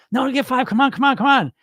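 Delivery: high, nagging inner voice; insistent pleading. Acted. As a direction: badger yourself into giving in, acted. Acted inner voice urging for more.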